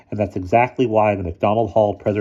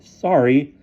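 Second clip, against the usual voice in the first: stunned; 8 semitones higher, 6 dB louder than usual. A narrator's reading. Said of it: "he said, still stunned".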